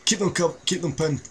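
high urgent command; urgent callout. Real, not acted.

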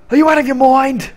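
high, loud put-on outrage; hammed-up disbelief; mock outraged yell. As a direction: bellow it in a cartoon voice. Acted outburst, first take.

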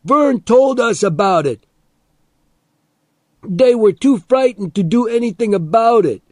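loud, high-pitched taunting yell; high defiant shout; shouting back. A narrator's reading. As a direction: hurl it back at full shout. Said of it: "Teddy shouted back".

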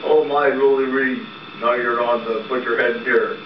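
normal talking voice

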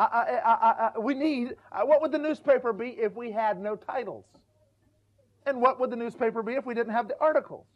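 ordinary talk

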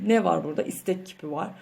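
ordinary talk, nothing marked

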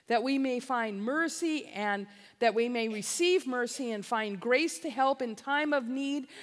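ordinary delivery

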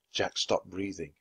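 usual speaking voice

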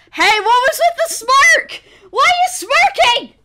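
high, loud squawk of protest; indignant shout. Real, not acted. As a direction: demand an answer, voice shooting up. Challenges boyfriend's smirk in chat.

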